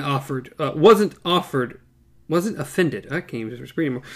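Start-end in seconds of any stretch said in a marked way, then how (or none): none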